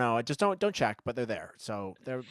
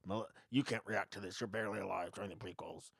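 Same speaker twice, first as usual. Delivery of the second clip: gatekeeping fan impression; 3 semitones lower, 7 dB quieter than usual. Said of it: "I can do it again".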